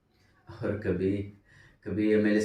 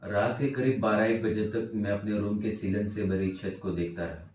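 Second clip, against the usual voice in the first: ordinary delivery, nothing marked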